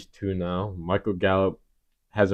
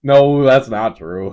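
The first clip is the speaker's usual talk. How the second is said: loud protest; 6 semitones higher, 11 dB louder, slower than usual. Real, not acted. Defending himself against teasing.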